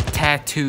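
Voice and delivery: "tattoo" with both T sounds said firmly and loud; tattoo with clear T. Shown as a demonstration. Vlogger correcting an Australian's speech, slow, crisply pronounced "tattoo". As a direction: stretch "tattoo" out, hitting the middle T. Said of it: "you sound like a preschooler".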